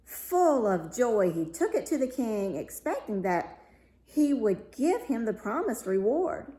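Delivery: ordinary delivery, nothing marked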